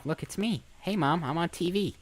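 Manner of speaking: ordinary talk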